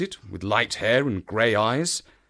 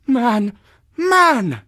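high, loud, exasperated plea; urgent cry; 12 semitones higher, 7 dB louder than usual. A narrator's reading. Woman cries a warning to a stranger, urgent. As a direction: cry out, rising in desperation. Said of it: "she cried".

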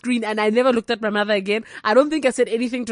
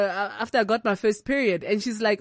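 ordinary talk

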